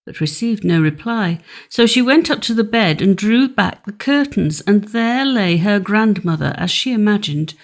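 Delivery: plain reading voice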